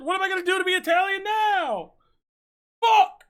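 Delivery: high, loud frustrated shout; angry yell. Real, not acted. Streamer learns 23andMe went bankrupt, furious shout. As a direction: blow up at the bad news. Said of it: "That sounded like I was really mad".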